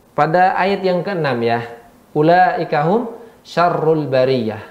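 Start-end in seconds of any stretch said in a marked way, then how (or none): 2.1-4.6 Quran recitation. Shown as a demonstration. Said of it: "Ra dibaca tebal apa tipis".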